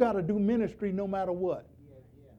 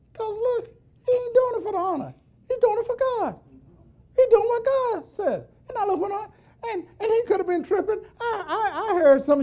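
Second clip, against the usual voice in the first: fervent; 10 semitones higher than usual. Real, not acted. Preacher building up fervently.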